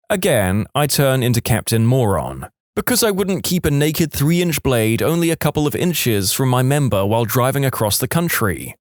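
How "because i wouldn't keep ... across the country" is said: ordinary delivery, nothing marked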